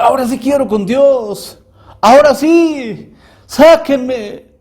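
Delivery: very high, stretched-out pleading cries; imitated screaming. People in hell begging to be let out, screaming. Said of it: "gente que está en un mar de lumbre gritando".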